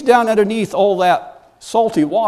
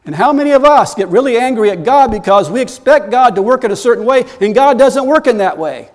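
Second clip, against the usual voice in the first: normal talking voice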